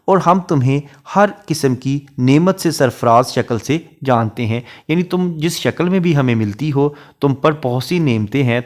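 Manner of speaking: ordinary talk, nothing marked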